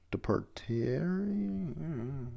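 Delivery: slow, quiet attempt at a hard word; sounding out. Real, not acted. Reader stuck on the narrator's word, sounding out.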